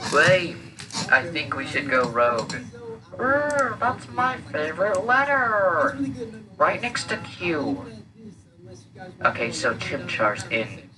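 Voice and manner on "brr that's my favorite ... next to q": high, slow goofy creature voice; dopey little creature voice; high silly creature. Acted.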